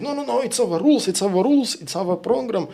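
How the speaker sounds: fast high mock protest; mimicking flustered official. Official brushing off criticism, fast high mock fluster. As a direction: rattle off her excuse in a high, hurried voice. Mimicking her defensive reply.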